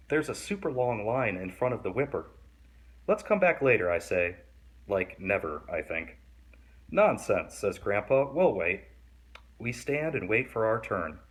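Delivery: put-on lively read-aloud for kids; story reading. A narrator's reading. Reading a picture book to kids, lively storytelling. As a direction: read the picture book to young listeners, animated.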